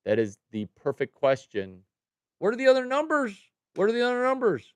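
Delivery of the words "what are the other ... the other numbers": high, loud exasperated shout; demanding yell. Real, not acted. Streamer yelling at viewer Cowboy for his numbers, exasperated shout. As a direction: yell the question at him, fed up.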